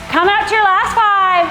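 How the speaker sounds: ordinary delivery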